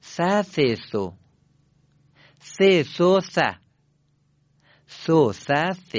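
ث run together as th syllables; teacher models linked th; continuous th syllables. Shown as a demonstration. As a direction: chain the ث syllables smoothly.

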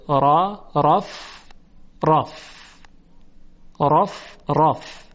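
رف repeated with a thick, full ra; heavy ra drill. Shown as a demonstration. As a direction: isolate رف and sound the ra heavy each time. (تکرار رف با رای درشت)

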